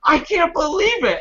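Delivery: very high, exasperated imitation; mock dismay, pitched right up; angry grandma. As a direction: imitate grandma in disbelief and outrage. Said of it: "my grandma was hot".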